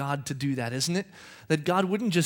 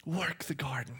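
whisper; 3 semitones lower, 10 dB quieter than usual. Shown as a demonstration. God whispers "Work the garden" to man.

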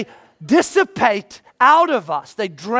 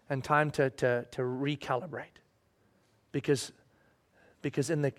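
hushed reflection; 8 semitones lower, 10 dB quieter than usual. Real, not acted. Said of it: "softens to reflective close".

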